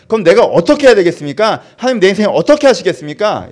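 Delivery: high, very loud impassioned plea; crying out to God. Shown as a demonstration. Preacher demonstrating how to cry out to God, loud and high. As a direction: cry it out at full force, pitched high. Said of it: "이렇게 부르짖는 거예요".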